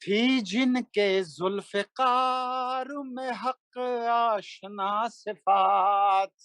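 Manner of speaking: slow melodic chanted Urdu verse; stately nazm recitation. Acted. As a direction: intone each line like a mushaira poet. Recites a manqabat at a gathering.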